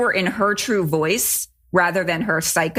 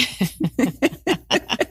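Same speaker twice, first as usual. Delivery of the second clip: short laugh; 5 semitones higher than usual. Real, not acted. Joke about talking out of ass.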